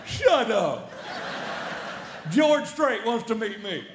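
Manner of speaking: can't believe what he just heard; slow awestruck disbelief; stunned disbelief. Retold or imitated. Stunned reaction to [name] news.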